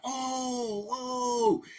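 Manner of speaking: high, drawn-out "oh"; imitated crowd groan. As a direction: mimic shocked onlookers' "oooh". Onlookers reacting to the blood.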